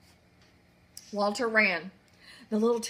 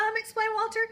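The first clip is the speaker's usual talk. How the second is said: plain reading voice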